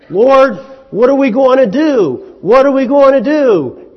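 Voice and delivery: a frantic plea to God; panicked king praying. Acted. Hezekiah cries to the Lord as Assyrians come, loud panic.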